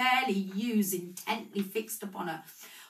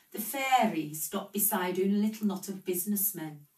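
plain reading voice

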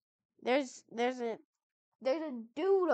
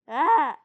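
startled yelp; 11 dB louder than usual. Real, not acted. Player yelping when a creature appears, startled. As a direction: jump at the fright with a yelp. Drowning in the game.

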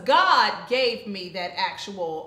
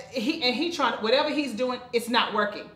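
normal talking voice

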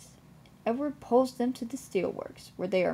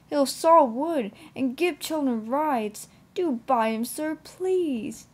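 higher, louder, coaxing appeal; eager pleading; 5 semitones higher, 5 dB louder, slower than usual. A narrator's reading. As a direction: plead eagerly, pitch lifting on the final please. Edward begs the vicar.

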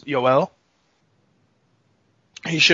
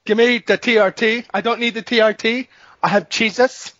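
higher-pitched mock accented voice; Vitor impression; 6 semitones higher than usual. Fighter turns down the treatment for his faith, mock accent. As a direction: insist like a devout accented fighter. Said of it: "that's Vitor".

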